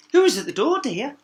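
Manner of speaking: queen asking her daughter. A narrator's reading. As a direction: ask gently as the queen. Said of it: "asked the queen".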